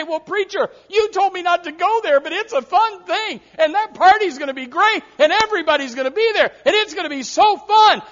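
high, loud, eager pleading to the preacher; mock tempted churchgoer. Churchgoer begging preacher to let them party, high eager mock plea.

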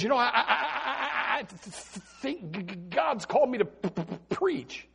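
a light stammering voice, soft and broken; imitating a stutter. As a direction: stumble shyly over each word. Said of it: "maybe he stutters".

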